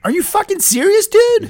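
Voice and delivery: very high-pitched outraged question; aghast disbelief. Acted. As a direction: exclaim in total shock, voice leaping. Host aghast at guest's drug use.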